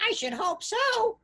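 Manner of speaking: put-on very high squeaky street-kid voice; urchin voice. A narrator's reading. Dirty urchin answering old miser, high squeaky voice. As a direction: play a cheeky urchin.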